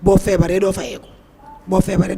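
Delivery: usual speaking voice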